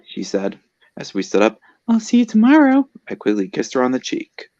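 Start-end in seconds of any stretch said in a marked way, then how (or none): 1.9-2.9 very high-pitched character farewell; squeaky, girlish farewell; girlfriend's goodbye. A narrator's reading.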